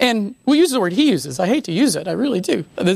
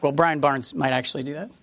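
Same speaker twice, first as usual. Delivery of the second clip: quiet joking aside; 9 semitones lower, 7 dB quieter than usual. Pretend. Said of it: "And I'm like".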